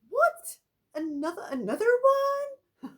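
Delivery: very high, loud, drawn-out What; a mother hearing of yet another ghost; shocked disbelief. Retold or imitated. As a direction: squeal in disbelief.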